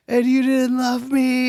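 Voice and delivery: high, slow mock sob; a girlfriend wailing about a bad dream, mock crying. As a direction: whine it like a fake-crying girlfriend. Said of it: "she starts crying".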